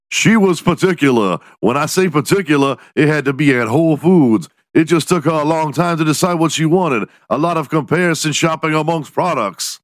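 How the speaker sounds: a hint of an exasperated soldier; quoting the guard member. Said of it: "with the member saying".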